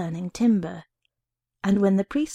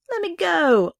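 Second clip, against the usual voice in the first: put-on high, louder cry of a boy pulling free; struggling cry; 9 semitones higher, 5 dB louder than usual. A narrator's reading. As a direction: cry out while dragging yourself away. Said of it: "trying to drag myself free of her".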